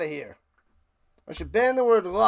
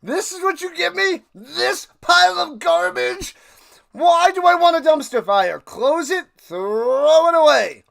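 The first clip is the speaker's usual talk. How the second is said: high, loud scornful voice; mock disgusted team; 10 semitones higher, 8 dB louder than usual. The Colts sneering at their bad quarterback, high and scornful. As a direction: sneer at the garbage you've been handed. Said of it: "they're like".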